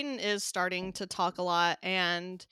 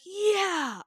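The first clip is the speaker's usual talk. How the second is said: much higher, bright "Yeah"; excited toddler; 11 semitones higher, 6 dB louder than usual. Retold or imitated. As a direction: squeal "Yeah" like a thrilled toddler. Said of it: "he'll be like".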